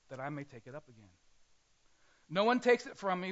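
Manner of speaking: ordinary talk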